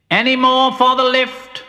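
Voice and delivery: high, loud attendant's call; crying out. Acted. Mole calling down the tunnel, high loud cry. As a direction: sing out to stray passengers. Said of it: "cried the mole".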